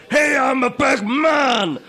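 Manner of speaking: loud, slow, high macho shout; big-man bluster. Retold or imitated. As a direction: puff up like an online tough guy. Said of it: "shit Matt impression".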